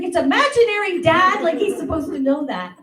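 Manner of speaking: put-on high, put-upon kid voice; exasperated little sister. Sister explains the imaginary ball to dad, high exasperated. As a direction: roll your eyes at dad like a kid sister. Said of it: "she's like".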